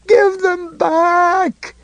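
high, slow, desperate bawl. A narrator's reading. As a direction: howl it like a broken man. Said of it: "he bawled again".

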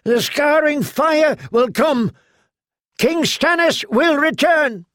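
very high, slow, strained shout. A narrator's reading. Knocked-down knight keeps shouting at the court, very high shout. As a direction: howl the prophecy from the floor. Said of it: "he continued to shout".

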